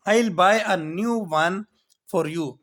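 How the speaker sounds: very slow, every word clear; slow model sentence. Shown as a demonstration. Teacher voicing the dad reassuring his son, slowly. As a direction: dictate the dad's promise. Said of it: "آگے سے ڈیڈ کہتا ہے".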